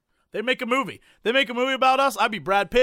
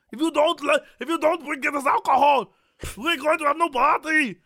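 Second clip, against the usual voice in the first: angry scolding; 6 semitones higher than usual. Pretend. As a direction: berate the drunk friend, frantic. Scolding friend about party.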